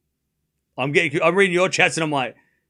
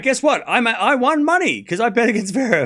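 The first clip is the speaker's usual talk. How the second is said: higher-pitched, excited blurt; excited announcement; 6 semitones higher than usual. Real, not acted. Streamer tells chat about a winning bet, excited. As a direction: share good news in a high, excited voice. Announcing a surprise betting win.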